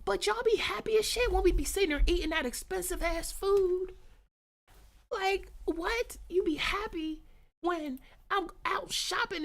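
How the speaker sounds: high, loud exasperated telling-off; mock scolding. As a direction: scold the gremlins in disbelief. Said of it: "I be like".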